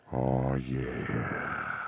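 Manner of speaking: a long low drawn-out oh yeah. Acted. Voice actor adds a low character purr, mildly done. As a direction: slide down low and savour it. Character voice after robot cop.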